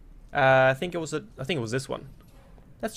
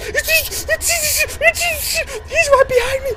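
far higher, louder terrified shriek; 8 dB louder than usual. Real, not acted. Gamer trapped with a monster behind, high terrified shriek. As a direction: shriek in sheer panic.

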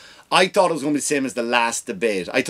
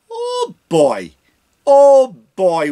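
exasperated exclaim; 10 semitones higher, 7 dB louder, much slower than usual. Real, not acted. Comic ranting about the debate, loud and high. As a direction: build up outrage.

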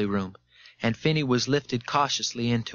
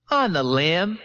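higher, put-on confident character voice; falsely confident; 6 semitones higher, 4 dB louder, slower than usual. A narrator's reading. Witness answering his questioner, falsely confident. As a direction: pitch the answer up, too sure of it.

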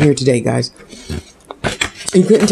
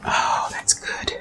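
whisper; 12 dB quieter, much slower than usual. Real, not acted. Savouring food, whispered aside.

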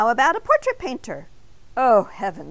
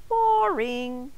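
high donut voice; 4 semitones higher than usual. A narrator's reading. Arnie voiced in story.

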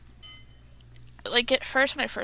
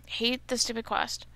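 usual speaking voice

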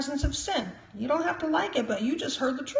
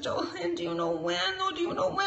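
soft, higher pleading imitation of someone in tears; mock tearful pleading; imitated crying; 5 semitones higher, 5 dB quieter than usual. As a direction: softly mimic a tearful plea. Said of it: "she was crying so bad".

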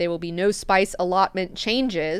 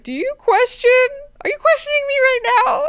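indignant god-emperor; 9 dB louder than usual. Retold or imitated. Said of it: "Leto's like".

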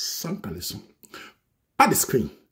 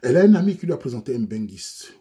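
ordinary talk, nothing marked

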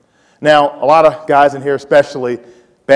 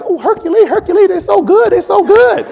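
very high, squealing falsetto voice; gushing fan; 4 dB louder than usual. Retold or imitated. Seniors mocking freshmen at their lockers, gushing in falsetto. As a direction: gush in a high put-on squeal.

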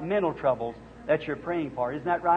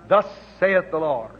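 solemn pronouncement. Real, not acted. Preacher to sick woman, solemn declaration. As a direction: pronounce it as prophecy. Prophetic declaration during healing.

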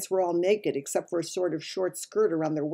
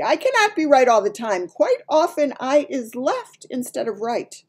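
louder, higher giant, protesting; defensive giant; 8 semitones higher, 6 dB louder than usual. A narrator's reading.

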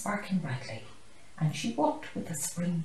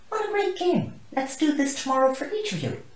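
high, louder delighted praise; teacher praising her class, higher and louder; cheering; 10 semitones higher, 8 dB louder than usual. Acted.

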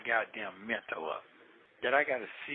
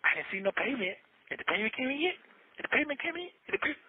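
much higher, nagging mock coach voice; 10 semitones higher than usual. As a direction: imitate the coach pestering about payment. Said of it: "Call coach every night".

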